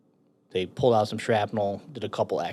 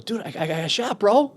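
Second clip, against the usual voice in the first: high mock complaint, louder on the last word; worked-up announcement; 10 semitones higher, 4 dB louder than usual. Frank showing his friend the leg wound, worked-up. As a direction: imitate a buddy exclaiming, voice jumping up.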